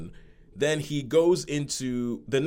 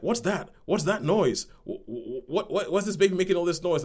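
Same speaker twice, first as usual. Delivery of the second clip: confused alarm; 7 semitones higher than usual. Retold or imitated. Confused parents hear crying baby.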